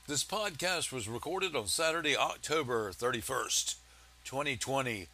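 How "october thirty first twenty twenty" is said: normal talking voice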